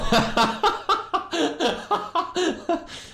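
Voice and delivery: long, very high cackling laugh; high laughing fit. Real, not acted. Streamer cracking up about the sundial, high laughing fit. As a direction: let a squealing laugh run on.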